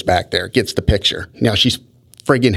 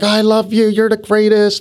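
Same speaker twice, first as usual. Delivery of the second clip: much higher-pitched mock adoring woman; imitating gushing fan; 10 semitones higher than usual. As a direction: mimic a besotted woman calling back as she's pulled away. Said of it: "She's waving back like".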